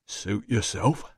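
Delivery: slow doorman voice; indifferent doorman. A narrator's reading. Doorman shrugging off a refusal, slow and indifferent. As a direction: shrug it off, slowly.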